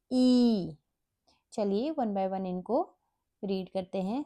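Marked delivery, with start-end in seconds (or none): 0.1-0.8 "ई" as a held, drawn-out ee; long E sound. Shown as a demonstration. Teacher shows what the long E patterns sound like, drawn out. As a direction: stretch "ई" into one long ee. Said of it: "उनका sound long".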